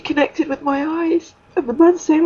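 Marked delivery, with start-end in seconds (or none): none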